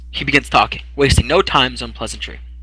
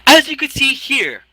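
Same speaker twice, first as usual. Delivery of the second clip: much higher, slow fussy clerk; high fussy clerk reading out; 14 semitones higher, 5 dB louder, slower than usual. Acted. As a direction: play a prim little scribe.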